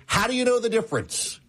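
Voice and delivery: high-pitched, incredulous professor question; mock professor challenge. Professor pressing the student on elephants, high and incredulous.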